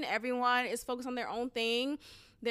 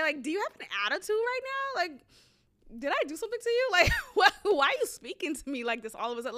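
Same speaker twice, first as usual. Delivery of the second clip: incredulous, high-pitched confronting; indignant comeback; 6 semitones higher, faster than usual. Acted. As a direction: challenge her attitude, voice jumping up high. Indignant imagined comeback to interviewer.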